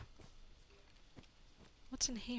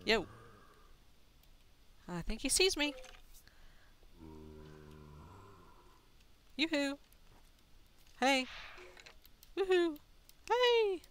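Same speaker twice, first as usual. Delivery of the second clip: yoo-hoo at someone far away; calling out; 7 semitones higher than usual. Real, not acted. Calling out to a skeleton.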